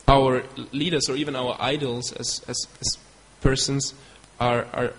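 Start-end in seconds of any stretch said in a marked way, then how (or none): none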